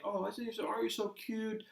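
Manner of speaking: put-on high, cooing mother-to-baby voice; sing-song baby talk. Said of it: "they'll talk in a sing-song voice".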